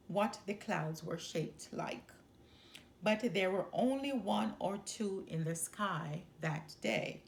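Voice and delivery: ordinary delivery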